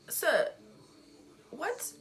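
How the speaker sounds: incredulous high "what?"; shocked disbelief. Retold or imitated. Student to the headmaster, stunned disbelieving question. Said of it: "I'm like".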